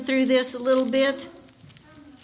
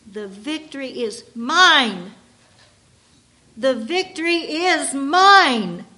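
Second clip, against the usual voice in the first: slow, loud proclaiming of a hymn; dramatic reading; 5 dB louder than usual. Shown as a demonstration. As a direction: read it as a personal claim of triumph. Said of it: "listen how I'm going to read this song".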